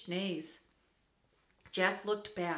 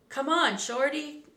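loud, raised-pitch holler; urgent call; 7 semitones higher, 7 dB louder than usual. A narrator's reading. Cowboy calling the boy to flee, urgent shout. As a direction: call out to hurry him along.

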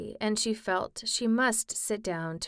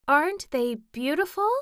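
put-on girl's voice; 7 semitones higher, 4 dB louder than usual. Dorothy voiced in story.

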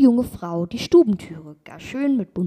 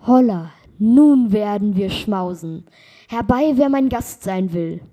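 loud, hearty shout to anyone nearby; joyful call; 8 dB louder than usual. Acted. Traveller gleefully calling guests to his meal, loud and hearty. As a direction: boom it out like a happy wanderer inviting guests. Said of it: "freute sich der vielen Gaben und rief".

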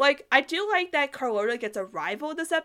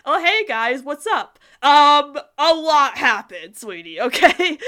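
louder, breezy mock-casual Roddy Ann; imitating Roddy Ann; 4 semitones higher, 11 dB louder, slower than usual. Roddy Ann breezily greeting the others after the chaos, louder and slow. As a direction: play it loud and carefree, slowing at the end. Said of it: "she's like".

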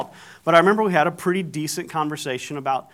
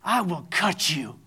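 slow, measured menace at usual pitch; retold threat; 5 dB quieter, slower than usual. Wife repeats her threat to husband, slow and menacing. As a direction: spell out the threat slowly, softer. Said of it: "She's saying".

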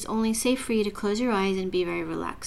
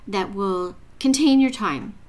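normal talking voice

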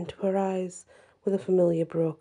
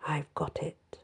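whisper; 3 semitones lower, 8 dB quieter than usual. A narrator's reading. Boy whispering to himself.

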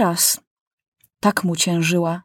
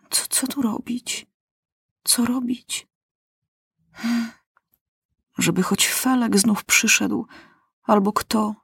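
anxious fretting; 5 semitones higher than usual. A narrator's reading. Maciuś panicking alone.

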